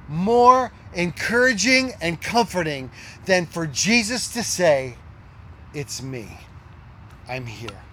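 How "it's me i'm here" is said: hushed comfort. Pretend. Voicing Jesus comforting.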